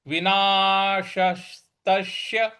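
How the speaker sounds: विनाशस्तस्य said very slowly, every syllable apart. Shown as a demonstration. Teacher slows the word for students. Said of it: "इस प्रकार से बोलेंगे".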